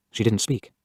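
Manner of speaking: plain reading voice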